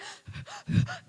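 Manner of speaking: a short, high, wry laugh; high embarrassed laugh. Real, not acted. Laughing at herself over the childhood bike fall, high wry laugh. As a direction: laugh it off at your own pain. Laughs recalling holding back tears.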